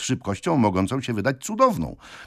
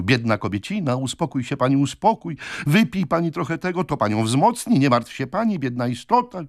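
high-pitched fussing landlady; exclamations of sympathy; 7 semitones higher than usual. A narrator's reading. Landlady comforts the fainting aunt, fussy exclamations. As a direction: cluck and fuss in a raised voice. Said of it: "po mnóstwie wykrzykników w rodzaju".

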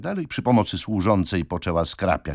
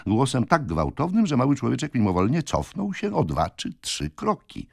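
plain reading voice

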